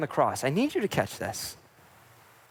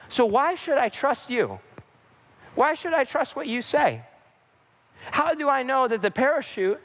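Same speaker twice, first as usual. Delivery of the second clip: accusing; 2 semitones higher than usual. Acted. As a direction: fire off pointed questions at whoever is to blame. Passenger confronts pilot over crash.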